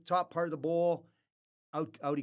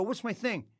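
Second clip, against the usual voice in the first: raised, flustered questioning; panicked; 7 semitones higher, 4 dB louder than usual. Retold or imitated. MC to Gretz after being told to do his thing, panicked. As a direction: throw the question back, flustered and louder. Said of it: "So I'm in a panic".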